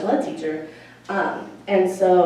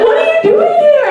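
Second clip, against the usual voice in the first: shocked question; 11 dB louder than usual. Retold or imitated.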